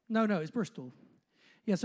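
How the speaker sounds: usual speaking voice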